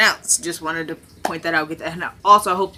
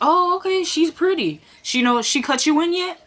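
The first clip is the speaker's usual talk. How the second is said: high, sly, mocking Candace voice; catty high voice; 10 semitones higher than usual. Candace needling the bartender about the new girl, sly high mock. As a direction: coo it with a sly edge. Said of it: "Candace sizing up new girl".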